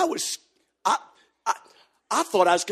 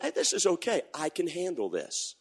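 inner thought; 7 dB quieter than usual. Retold or imitated. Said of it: "And I thought".

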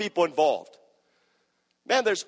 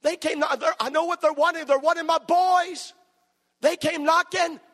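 desperate widow; 7 semitones higher than usual. Retold or imitated. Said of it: "She said".

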